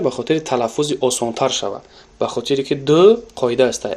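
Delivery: usual speaking voice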